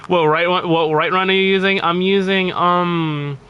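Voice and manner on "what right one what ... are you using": ordinary delivery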